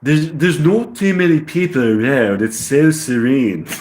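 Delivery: put-on English accent, slowing at the end; British accent. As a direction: exaggerate an amateur British accent. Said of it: "I get the British accent".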